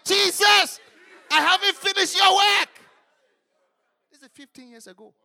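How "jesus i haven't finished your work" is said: much higher, loud desperate cry; retold shout. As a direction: shout it out as if death is coming. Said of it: "So I shouted".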